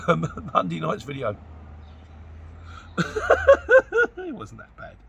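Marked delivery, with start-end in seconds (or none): 1.4-4.1 real laugh. Real, not acted. Laughs at own outtake. 4.2-5.0 laughing. Real, not acted. Laughing after the outtake.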